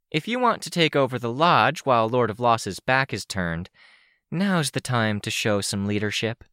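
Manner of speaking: plain reading voice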